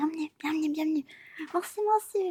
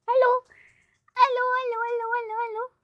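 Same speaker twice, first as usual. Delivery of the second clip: high calling allô; 12 semitones higher, 4 dB louder, faster than usual. Acted.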